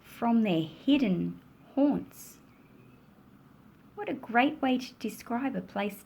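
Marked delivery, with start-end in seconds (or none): 0.0-2.4 exuberant, rhythmic picture-book reading; story narration. A narrator's reading. Children dance and run in the rain, joyful storytelling. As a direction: lift the list of actions with rising delight.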